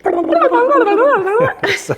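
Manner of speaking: high, loud babbling noise; imitated racing mind. Host showing what their mind would be like, frantic babble. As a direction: burst into fast high nonsense sounds. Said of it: "My mind would be like".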